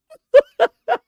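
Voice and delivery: a loud, high cackling laugh. Real, not acted. Streamer recalling the joke, loud high laugh. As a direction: let the laugh burst out of you.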